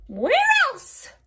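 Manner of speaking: high, loud smug hag; witch voice. A narrator's reading. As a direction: toss it off knowingly. Witch answers herself.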